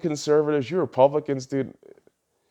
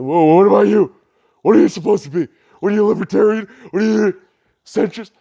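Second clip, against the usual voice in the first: louder, higher, sneering mock heckler; mocking extremist voice; 5 semitones higher, 5 dB louder, faster than usual. Ranter voicing extremists from both sides heckling him, louder and higher in a mocking tone. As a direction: jeer at him like a smug heckler. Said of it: "I can already hear the hypothetical extremists".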